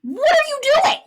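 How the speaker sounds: very high, loud disbelief; incredulous shriek. Real, not acted. Podcaster mocking the man arguing from the fridge, a high shriek. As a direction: shriek it like you can't believe him.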